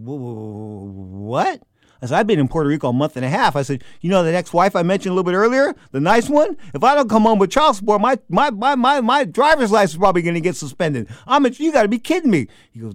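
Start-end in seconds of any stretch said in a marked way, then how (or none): 0.0-1.6 stunned disbelief. Retold or imitated. Host told he's not getting paid, acting stunned. 2.2-12.5 loud, high-pitched exasperated rant; outraged protest. Retold or imitated. Unpaid host to promoter about child support, loud and exasperated.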